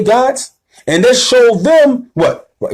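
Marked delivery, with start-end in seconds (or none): none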